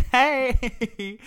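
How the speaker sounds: high, loud sing-out hello; mock-cheery, high-pitched greeting; chirpy phone greeting. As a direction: imitate an over-friendly caller. Retold phone greeting to hotel.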